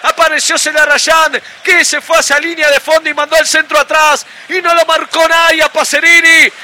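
shouted, high-pitched football goal call with long stretched names. Real, not acted. Radio commentator announcing Belgrano's goal to listeners, full-force goal call. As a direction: belt out the goal and keep the pitch up to the last name.

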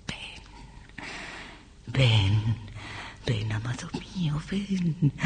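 soft, breath-led summons; beckoning whisper. Acted. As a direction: whisper it to lure a lover closer. Calling an absent lover. (susurro seductor)

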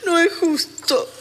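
tearful protest, starting high; llorando. Acted. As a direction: plead through tears. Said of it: "deja de llorar". (protesta llorosa)

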